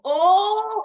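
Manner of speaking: loud, high drawn-out "oh"; mock outburst. Shown as a demonstration. Counselor shows someone about to lose it, loud mock outburst. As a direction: swell into a demonstrated losing-it cry. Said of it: "So if you go".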